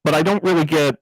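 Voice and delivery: overdriven, distorted mic sound; cop voice filter. Acted. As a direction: talk through a gain-blown cop filter. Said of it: "sound like someone who has their gain turned up too much".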